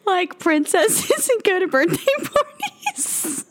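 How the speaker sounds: high, giggly confession; slowing and cracking up as it goes; laughing. Real, not acted. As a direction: push the words out through real laughter. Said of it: "I don't know why I'm laughing".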